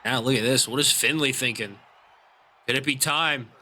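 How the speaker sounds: steady play-by-play ringside call; wrestling commentator. Acted. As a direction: call the match like a TV announcer.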